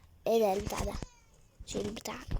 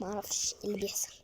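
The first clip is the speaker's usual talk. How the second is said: whisper; 4 semitones lower, 9 dB quieter than usual. Real, not acted. Whispered puzzled aside.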